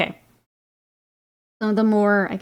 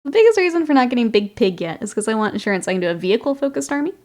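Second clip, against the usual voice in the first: normal talking voice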